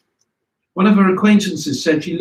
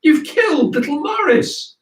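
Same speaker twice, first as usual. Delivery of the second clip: much higher, shrieking mock wail; hysterical wailing; 13 semitones higher than usual. Mother over her bleeding son, hammed-up hysterical wail. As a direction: cry out like a frantic mother. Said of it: "wailing hysterically".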